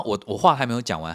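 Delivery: ordinary talk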